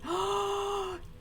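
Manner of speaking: sharp high gasp of delight. Acted. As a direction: gasp like a child seeing a gift. Character thrilled by tea gift.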